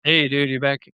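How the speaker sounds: usual speaking voice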